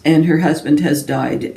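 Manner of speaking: ordinary delivery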